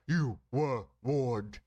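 slow, loud, booming doom. Acted. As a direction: boom out the warning slowly. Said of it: "we're going with that".

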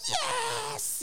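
high excited cheer. Retold or imitated. Family cheering the shy niece finishing her dance. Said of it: "we were all very cheery".

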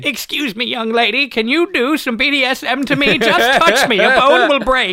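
put-on horny old man voice.

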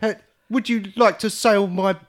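stiff, high, loud overacted line; wooden bad acting. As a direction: parody a terrible wooden actor. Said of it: "the most wooden actors I've ever seen".

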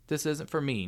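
talking through cookie crumbs; mouth full. A narrator's reading. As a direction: say it around a mouthful. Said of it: "around a mouthful of cookie".

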